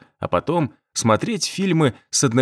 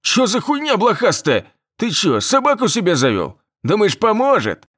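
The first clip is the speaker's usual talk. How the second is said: panicked thug; 9 semitones higher than usual. A narrator's reading. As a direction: shout in alarmed disbelief.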